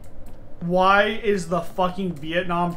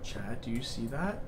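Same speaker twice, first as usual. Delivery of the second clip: much softer, lower, hushed to chat; hushed dread; 7 semitones lower, 14 dB quieter than usual. Real, not acted. Streamer finding Liam's body asks chat in a hushed voice. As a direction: barely breathe the words out.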